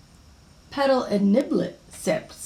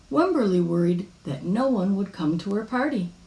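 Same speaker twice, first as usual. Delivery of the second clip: usual speaking voice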